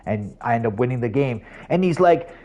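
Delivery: ordinary talk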